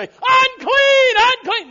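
shouted warning. Pretend. Brother Tim seeing the leper come in, mock shout. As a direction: bellow the alarm at the intruder. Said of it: "Brother Tim would say".

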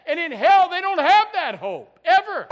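loud, high fiery warning; preaching shout. Acted. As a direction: hammer it home at full force.

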